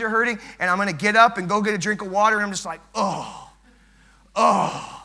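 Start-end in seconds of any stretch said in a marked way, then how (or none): 2.9-3.5 imitated groan of a sore back; mock achy groan; pained groan. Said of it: "I'm groaning". 4.4-5.0 mock groan of aching legs; hammed-up sore groan; pained groan. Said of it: "I'm groaning".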